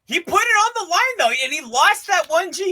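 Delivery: high, incredulous recap; excited emphasis. Real, not acted. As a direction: pitch way up, stretching out the final words. Hammering home the lost bet.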